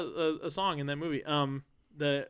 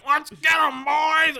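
high shouted charge; rally the troops with a yell; mock war cry from a cartoon bug; battle cry; 10 semitones higher, 6 dB louder, much slower than usual. Cockroaches charging in movie.

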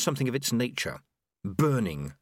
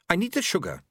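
higher, defensive retort; snapping; 8 semitones higher than usual. Acted. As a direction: snap it out defensively, pitch raised. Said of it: "she snapped".

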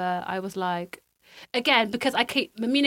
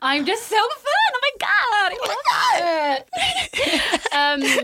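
high, slow hammed-up squeal of delight; over-the-top self-adoring squeal; mock diva squeal; 11 semitones higher, much slower than usual.

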